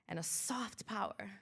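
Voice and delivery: soft unvoiced whisper. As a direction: breathe the line out.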